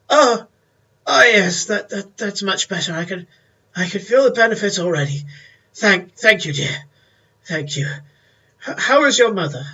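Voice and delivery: put-on a pleased old gent warming up; old man voice. Old man thanking Mary for the fire, doddering. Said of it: "He goes".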